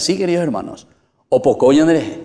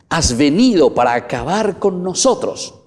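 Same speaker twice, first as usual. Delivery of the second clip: a higher mock cry of the demon; demon's question; 7 semitones higher, 3 dB louder than usual. Preacher voicing the demon questioning Jesus, mild mock cry. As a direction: throw the question out as the demon would. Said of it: "la segunda preguntita".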